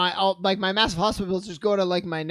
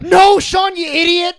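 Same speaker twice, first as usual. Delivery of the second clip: mock shout; 9 semitones higher, 9 dB louder, slower than usual. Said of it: "everybody's like".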